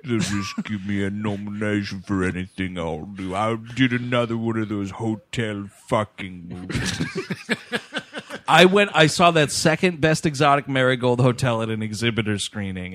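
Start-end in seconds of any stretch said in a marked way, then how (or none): 0.0-7.0 put-on smug old British actor voice; Bill Nighy impression.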